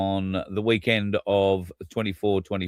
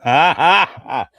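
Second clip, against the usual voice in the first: loud laugh; 7 semitones higher, 8 dB louder than usual. Real, not acted. Laughs at barter joke.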